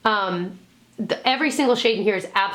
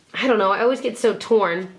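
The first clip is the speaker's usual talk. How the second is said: ordinary talk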